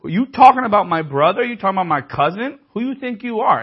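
mock confrontation. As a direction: get up in their face, defending your own. Family defending him, acted quarrel.

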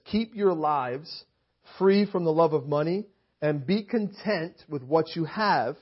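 slow, deliberate reading of the verse; scripture reading. Acted. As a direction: lay out each word slowly.

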